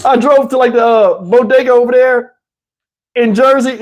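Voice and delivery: ordinary talk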